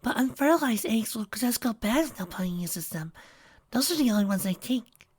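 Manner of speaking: high, gentle pony voice; timid Fluttershy. A narrator's reading. Fluttershy explaining chicks to Trixie, in a gentle high voice.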